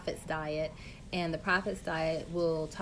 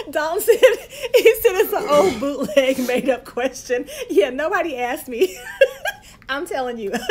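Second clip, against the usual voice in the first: laughing; 8 semitones higher, 13 dB louder than usual. Real, not acted. Laughing at husband's heckle.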